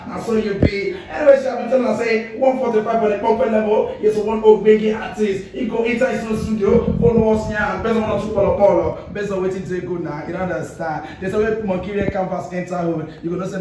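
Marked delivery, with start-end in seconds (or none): 0.1-9.1 loud, high radio hype voice; energetic radio host shouting the show open; hype DJ intro. Acted. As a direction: pump up the listeners like a club DJ.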